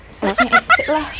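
high urgent squeak. Acted. Sudden urge to poop.

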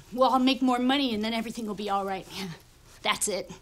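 ordinary delivery

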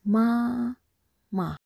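"Ma" sustained on a high, even pitch; high level tone. Shown as a demonstration. Teacher repeats "Ma" for learners, high level tone. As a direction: hold each "Ma" high and steady. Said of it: "The first tone is very high level".

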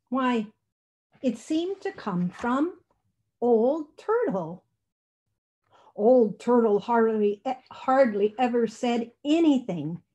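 usual speaking voice

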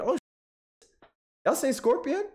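high, surprised squeak at the chat. Real, not acted. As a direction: caught off guard, voice jumps up. Reacting to chat's surprising vote.

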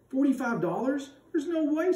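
can't believe the number she just heard; stunned disbelief. A narrator's reading.

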